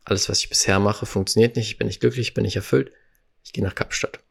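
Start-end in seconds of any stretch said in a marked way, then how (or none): 3.4-4.2 breathy, unvoiced whisper of the decision; whispered resolve. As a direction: whisper it like a secret vow. Entscheidung wiederholt, geflüstert. (geflüsterter Entschluss)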